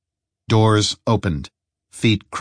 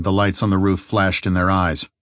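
plain reading voice

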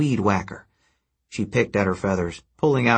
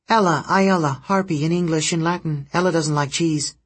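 high jittery harpy, no breath; breathless rush; 6 semitones higher than usual. A narrator's reading. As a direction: rattle it off without breathing. Harpy Ella's voice, one breath.

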